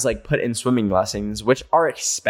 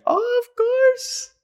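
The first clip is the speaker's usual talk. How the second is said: squeaky of course; much slower than usual. Acted. Replying to listener in cute voice.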